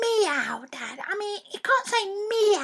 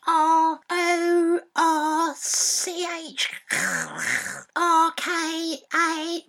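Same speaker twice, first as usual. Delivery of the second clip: slowly spelled roar; 2 semitones lower, much slower than usual. Shown as a demonstration. Boy slowly spelling the lion's roar for dad. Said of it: "so it's a C".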